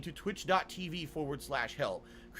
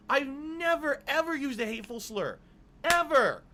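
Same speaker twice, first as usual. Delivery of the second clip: emphatic denial; 6 semitones higher, 4 dB louder than usual. Real, not acted. Denies using slurs, heated.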